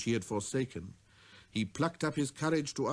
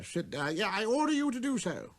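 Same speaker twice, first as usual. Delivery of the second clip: proud king summoning a visitor; king's command; 10 semitones higher than usual. Acted. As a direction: call him closer with pride. Voices the king.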